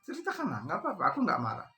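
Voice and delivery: high, light and soothing, inviting her to talk; coaxing gently. A narrator's reading. Musa urges the crying woman for the third time, high and soothing. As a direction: sound like you are coaxing a scared child.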